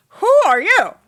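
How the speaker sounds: loud, high demanding challenge; a rude, loud who-are-you to an intruder; rude introduction. Acted. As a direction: bark it at a stranger you don't like. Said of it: "Like, rude introduction".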